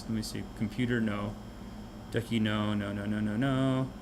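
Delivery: normal talking voice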